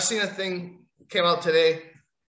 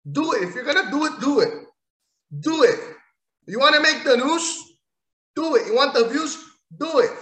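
high, loud goading chant; fired-up taunt; taunting dare; 7 semitones higher, 4 dB louder than usual. Real, not acted. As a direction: egg him on, louder each time. Daring the wrestler to swing.

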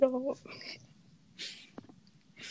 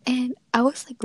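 ordinary delivery

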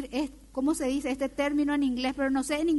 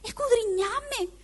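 higher, slow, pleading prayer to God; humble prayer; 5 semitones higher than usual. Acted. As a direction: lift it up like a sincere plea to the Lord. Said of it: "¿qué es lo que yo hago?".

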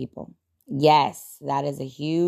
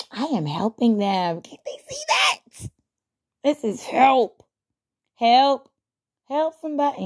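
exasperated insistence; 8 semitones higher than usual. Retold or imitated. Inner voice insisting on helping.

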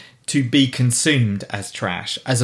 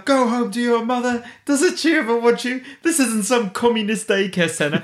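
high, shrieking mock tirade at the children; mock shrill shout; 10 semitones higher than usual. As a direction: screech it like a hysterical neighbour. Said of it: "when she's shouting at the children".

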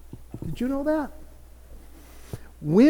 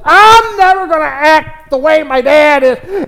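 vowing never; 7 semitones higher, 9 dB louder than usual. Retold or imitated. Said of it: "you've never shocked yourself".

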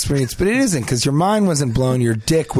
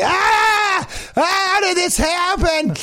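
mock scream, much higher, drawn-out question; panicked shriek; much slower than usual. Man staring at his pelvis, hammed-up horrified shriek. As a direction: shriek in horror at your own pelvis.

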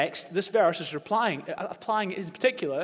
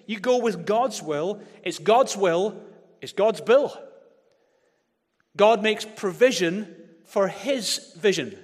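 slow, louder, rising preacher cadence; full-force preaching; 4 semitones higher, 7 dB louder, slower than usual. Real, not acted. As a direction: hammer the rhyme home.

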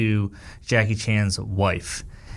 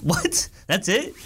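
higher-pitched, baffled reaction; mock disbelief; 7 semitones higher, slower than usual. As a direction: question it as if badly let down. Mocks the feeble superpower.

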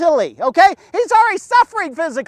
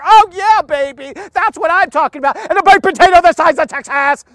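hammed-up relish; 3 semitones higher, 3 dB louder than usual. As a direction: whoop it up over imagined steak dinner. Gleefully imagines a freezer of steaks.

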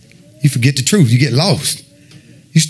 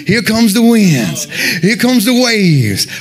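slow, drawn-out, higher-pitched build; dramatic storm announcement; 5 semitones higher, much slower than usual. Retold or imitated. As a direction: stretch each line out as the storm rolls in.

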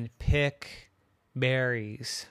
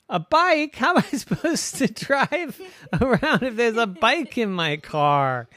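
laughing disbelief; 11 semitones higher, 5 dB louder than usual. Acted. Wolf outraged, laughing.

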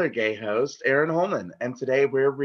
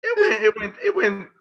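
shouting; 10 semitones higher, 5 dB louder than usual. Real, not acted. Emphatic outburst.

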